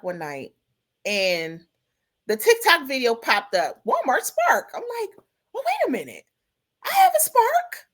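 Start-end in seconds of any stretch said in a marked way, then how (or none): 3.9-7.9 excited. Retold or imitated. Excited discovery of the app.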